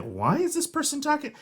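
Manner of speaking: high put-on voice of a baffled person; puzzled listener. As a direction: question it in a high, mock-puzzled voice. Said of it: "they might be like".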